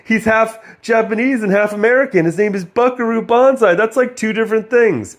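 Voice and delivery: high, loud, gushing mock voice; mock delighted script. Mocking the script, high loud fake delight. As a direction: gush like a self-pleased screenplay. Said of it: "like, be like".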